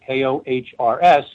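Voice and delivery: K O H R S read out one letter at a time; spelled letter by letter. Shown as a demonstration. Linguist reads out the girlfriend's misspelling, letter by letter. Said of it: "Michael Kors purse".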